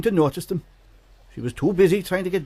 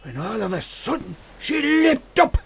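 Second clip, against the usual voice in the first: louder, higher jolt of storytelling; spring it on the kids; storyteller making the children jump; sudden dramatic burst; 6 semitones higher, 3 dB louder than usual. Acted.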